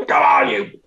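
high, loud screech across a film set; shrieked mock urgency, easing slightly at the end; screeching director. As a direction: imitate a frantic director screeching orders. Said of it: "Tom was screeching at the".

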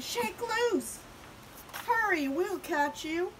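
high, urgent calling; shouting. A narrator's reading. Prairie dogs urging Big Bark free, shouting. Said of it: "the prairie dog shouted".

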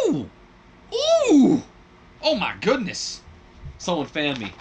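high, loud delighted squeal over the rating; excited squeal. Real, not acted. Booker thrilled by a C minus rating, high excited squeal. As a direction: gush like someone swooning.

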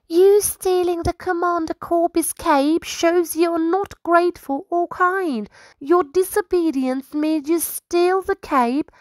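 high, earnest little squirrel voice; a small scribe solemnly advising his king; high-voiced squirrel counsellor, formal.

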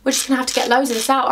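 normal talking voice

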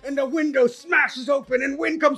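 high, urgent storytelling at a climax; dramatic narration. A narrator's reading. Storyteller describes the storm breaking in, high and urgent. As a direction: sweep breathlessly into the sudden danger.

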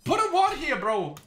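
high, half-laughing outburst at a teammate; teasing outcry over missing vision; laughing exasperated yell. Real, not acted. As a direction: yell at him, laughing in disbelief. Yelling at teammate in game.

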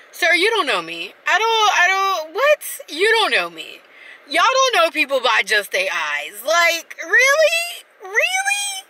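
high, incredulous and exasperated; can't believe he said that; exasperated disbelief. Retold or imitated. Ranting at the stranger who claims to know her, exasperated and incredulous.

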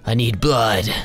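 slow, drawn-out creature voice; bloodthirsty raptor. Acted. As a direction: beg for blood like a hungry monster. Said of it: "He's like".

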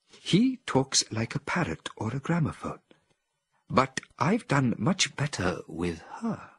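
lightly voiced Helios, proud; proud ape scientist. A narrator's reading. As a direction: brag about the better subject.

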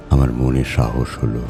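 usual speaking voice

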